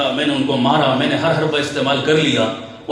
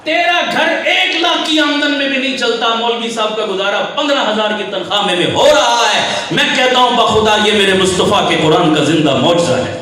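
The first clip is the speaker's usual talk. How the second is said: raised, emphatic sermon voice; forceful preaching; 4 semitones higher, 4 dB louder than usual. Real, not acted. Preacher challenging listeners over the maulvi's salary, forceful preaching. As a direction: press the point on the congregation.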